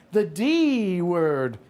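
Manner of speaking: "D" stretched out very long; drawn-out stress. Acted. Telling his partner which word offended, "D" heavily drawn out. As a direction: lean hard and slowly on "D".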